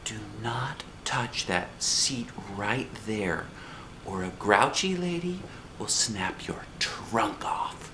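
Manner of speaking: slow, breathy secret whisper. A narrator's reading. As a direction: hush it into a friend's ear. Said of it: "I whispered in his softy ear".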